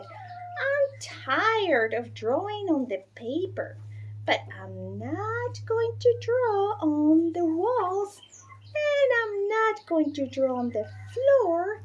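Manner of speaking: bored daughter telling her mother what she won't do; bored little girl. Acted. Bored Bridget declares.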